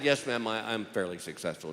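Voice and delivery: low, subdued reply to judge; meek answer. Acted. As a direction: back down quietly, with a small hesitation.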